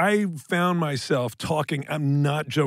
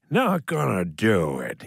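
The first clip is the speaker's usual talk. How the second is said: low, slow Jimmy Stewart drawl; stammering old Hollywood drawl, low; Jimmy Stewart doing Bush; 7 semitones lower than usual. Retold or imitated. Jimmy Stewart imitating Dana's Bush, low and slow. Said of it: "an impression of ... Jimmy Stewart".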